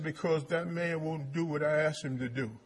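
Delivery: ordinary talk, nothing marked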